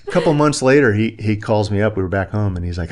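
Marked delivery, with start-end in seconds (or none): none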